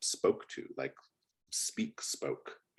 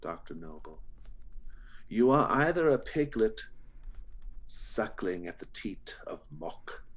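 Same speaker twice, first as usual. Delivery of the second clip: slow, theatrical menace, punching openings then sinking; sinister butcher. Acted. Butcher giving Doctor Noble a grim choice, slow and sinister. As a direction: preach the villain's creed with drawn-out words.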